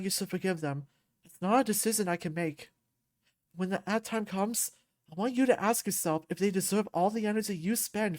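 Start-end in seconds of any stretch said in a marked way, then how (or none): none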